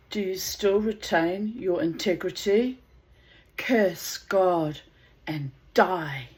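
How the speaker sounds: slow, loud, solemn Bible reading; scripture reading. Acted. Reading the Book of Job aloud to viewers, solemn. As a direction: intone it like a sermon.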